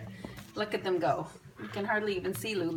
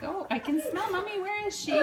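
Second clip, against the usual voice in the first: puppy voice; 12 semitones higher than usual. Pretend. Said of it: "They're like".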